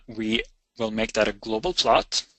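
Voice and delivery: ordinary delivery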